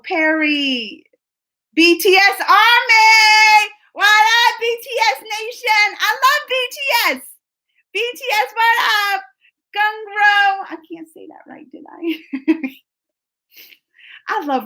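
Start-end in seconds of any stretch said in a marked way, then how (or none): 1.7-10.6 high, loud, drawn-out chant to the fans; hype shout-out. Real, not acted. Streamer hyping up BTS fans in chat with an excited shout. 10.7-12.7 sheepish unvoiced aside; whisper. Real, not acted. Streamer whispering an aside after mangling a word.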